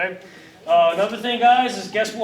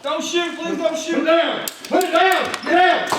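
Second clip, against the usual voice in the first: high, pleading shout that builds louder; panicked surrender shout; 8 semitones higher, 4 dB louder than usual. Acted. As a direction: beg them not to shoot, rising to a yell. Instructor plays suspect in drill.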